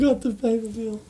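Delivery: squeaky high voice; high mock wail. Third repeat, mock despair.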